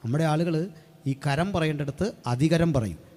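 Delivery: ordinary talk, nothing marked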